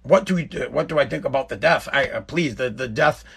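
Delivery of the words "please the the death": normal talking voice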